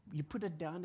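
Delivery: usual speaking voice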